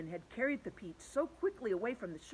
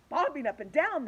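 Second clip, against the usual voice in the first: surprised cry; 7 semitones higher, 9 dB louder than usual. Acted. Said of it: "they cried".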